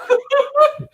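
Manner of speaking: high, loud burst of laughter; real laugh. Real, not acted. Laughing at the beer-taking story.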